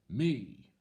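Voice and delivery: loud, bold shout. Acted. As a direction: announce yourself boldly. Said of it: "shouted Perseus boldly".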